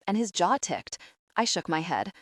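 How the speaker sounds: ordinary delivery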